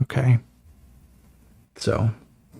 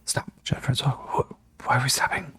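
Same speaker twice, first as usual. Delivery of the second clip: hushed, urgent whisper; tense whisper; 3 semitones higher, 5 dB quieter than usual. Acted. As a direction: whisper so nobody hears you. Hiding, afraid of being found.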